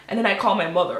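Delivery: normal talking voice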